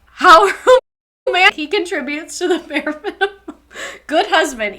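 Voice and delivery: loud, high derisive echo with a laugh; mocking. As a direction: scoff at it, laughing through the words. Mocking the line just read.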